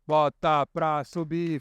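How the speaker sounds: slow, low, drawn-out chant; slow climb. Shown as a demonstration. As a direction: draw it out like a coaster climbing. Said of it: "é só fazer o que eu faço".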